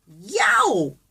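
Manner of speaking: loud yelp of pain; pig's yelp. A narrator's reading. Small pig bitten on the tail by a turtle, loud yelp. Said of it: "says the small pig".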